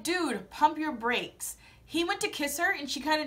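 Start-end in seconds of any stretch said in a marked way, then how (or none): 0.0-1.6 drawn-out slow put-down; slowly telling the suitor to back off; slow warning. Retold or imitated. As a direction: tell him to slow down. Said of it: "And I'm like".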